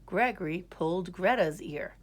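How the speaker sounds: ordinary delivery, nothing marked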